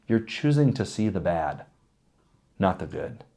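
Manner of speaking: normal talking voice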